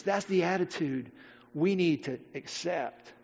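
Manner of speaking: normal talking voice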